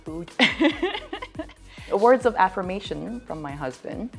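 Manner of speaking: ordinary talk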